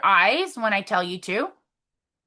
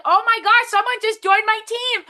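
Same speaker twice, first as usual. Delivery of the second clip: higher, louder thrilled gasp; excited; 8 semitones higher, 5 dB louder than usual. Pretend. Imagining the news of a new recruit, thrilled and louder. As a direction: burst with joy at the news. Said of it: "I want you to think".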